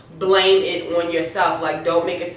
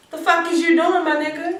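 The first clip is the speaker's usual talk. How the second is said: Papa Poke confronting; 9 semitones higher than usual. Retold or imitated. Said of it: "Papa Poke roll up on him like".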